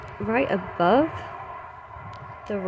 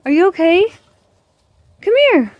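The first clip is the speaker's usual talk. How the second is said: high, loud coaxing; worried dog call; 9 semitones higher, 8 dB louder than usual. Real, not acted. Owner checks on her dog, high worried call. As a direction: call the dog over, pitched right up.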